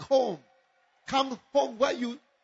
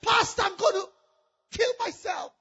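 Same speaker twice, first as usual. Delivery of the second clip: crying out; 8 semitones higher, 7 dB louder than usual. Acted. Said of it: "She cried".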